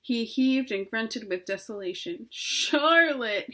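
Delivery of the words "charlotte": high, drawn-out moan of grief. A narrator's reading. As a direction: moan her name in sorrow. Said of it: "he moaned".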